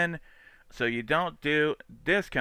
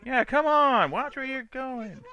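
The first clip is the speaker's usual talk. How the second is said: mock scolding; 13 semitones higher, slower than usual. Mock yelling at dashcam driver.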